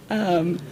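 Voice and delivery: unvoiced breathy nervous giggles; nervous breathy laugh. Real, not acted. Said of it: "I'm a little nervous still".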